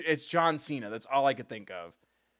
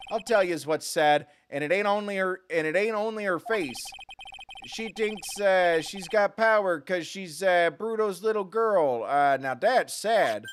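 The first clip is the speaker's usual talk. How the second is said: loud, higher wiseguy mobster sneering; 7 semitones higher, 5 dB louder than usual. Acted. Mobster mocking the boss's daughter, loud and higher. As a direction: sneer it like a mob heavy mocking the boss's girl. Voicing mobster game character.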